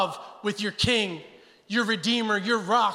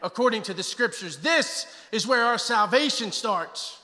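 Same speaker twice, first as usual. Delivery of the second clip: impassioned sermon delivery, rising and falling; preaching; 4 semitones higher than usual. Acted. Pastor preaching the cross to his congregation, impassioned. Preaching the gospel at full force.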